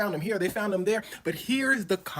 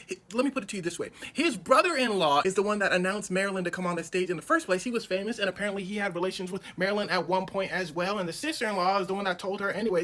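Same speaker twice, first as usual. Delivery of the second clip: ordinary talk, nothing marked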